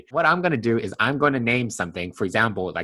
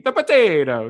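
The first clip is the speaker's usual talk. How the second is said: mimicking in a loud, high Italian accent; exaggerated, sing-out mock Italian accent; bad Italian accent; 7 semitones higher, 6 dB louder than usual. Host mocking his co-host's attempt, bad Italian accent. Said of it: "one of those really bad Italian accents".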